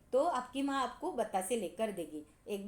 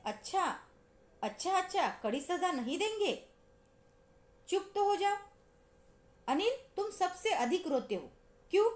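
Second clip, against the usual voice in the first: a hint of a teacher calming crying kids, higher; soothing teacher; 6 semitones higher than usual. Raat Rani calms the crying boys, lightly voiced soothing teacher. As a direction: hush the children gently but firmly, a little higher. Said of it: "रात रानी क्या कहती है".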